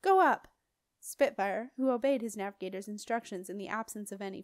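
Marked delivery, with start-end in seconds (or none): 0.0-0.5 loud, high shouted command; yelled. A narrator's reading. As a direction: yell the order upward. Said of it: "yelled his navigator".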